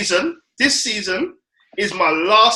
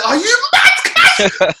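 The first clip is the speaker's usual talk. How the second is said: high, loud, laughing mock outrage; laughing disbelief; 4 dB louder, slower than usual. Friend in the chat, high laughing yelp of disbelief. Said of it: "I said".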